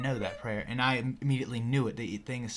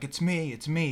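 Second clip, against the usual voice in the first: higher-pitched mild mock yell; retold yell; 5 semitones higher, 4 dB louder than usual. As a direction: re-enact a desperate call, only half yelled. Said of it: "'Cause I, I yelled".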